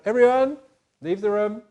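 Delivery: loud call to clear the room; commanding order. Acted. Joseph ordering everyone out of the room, loud command. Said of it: "he said to everyone".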